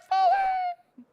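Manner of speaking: a very high, loud mock scream; falling scream. Gamer acting out a fall into the idiot box with a shrieking mock scream. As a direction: yell it like you're falling off a ledge. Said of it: "We've all been like".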